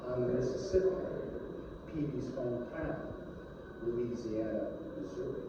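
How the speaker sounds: Ednick's last line as the screen goes dark, soft fade; fading close. Acted. Closing line as screen fades.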